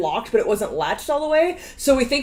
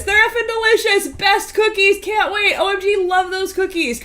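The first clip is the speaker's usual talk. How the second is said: excited gushing; 8 semitones higher than usual. Real, not acted. Gushing over the cookies.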